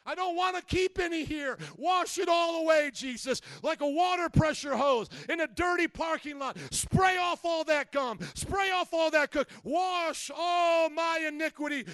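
shouting preacher; fiery preaching. Real, not acted. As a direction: preach it with full fire. Preaching at full force.

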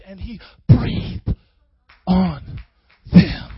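each word stretched and set apart; low, slow, with pauses between words; slow hushed emphasis. Real, not acted. Preacher picturing Jesus breathing on disciples, slow and low.

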